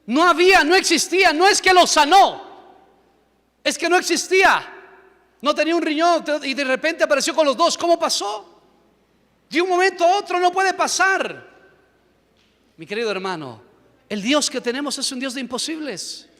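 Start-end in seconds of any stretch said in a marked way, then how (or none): 0.1-11.5 raised, emphatic preacher's voice; forceful preaching. Real, not acted. Preacher telling the congregation of a miracle, forceful. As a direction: preach the miracle loud and high.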